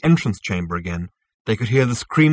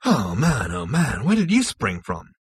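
dazed, swooping babble; wittering Zaphod; 2 semitones higher, 4 dB louder than usual. A narrator's reading. Zaphod slumps at the sight of Zarniwoop, dazed wittering. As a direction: witter it with the pitch wobbling up and down. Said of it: "His mouth said foolish things".

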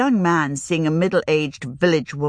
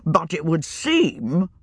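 put-on hesitant older wizard; slower than usual. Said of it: "began and stopped".

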